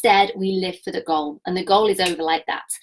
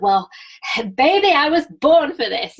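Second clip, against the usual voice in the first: higher, punched-up burst of self-belief; expansive wow energy; 5 semitones higher, 4 dB louder than usual. Acted. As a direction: declare it big, with a laugh breaking in. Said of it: "that kind of expansive wow energy".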